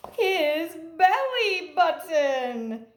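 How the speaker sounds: playful big reveal; delighted reveal. Acted. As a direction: savour the punchline for the kids. Surprise punchline reveal.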